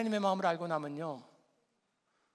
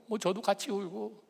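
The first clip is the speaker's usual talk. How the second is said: usual speaking voice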